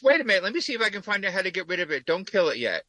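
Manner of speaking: rushed, fast pleading; hurried. Retold or imitated. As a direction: blurt it out quickly. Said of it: "the Bird Chat person goes".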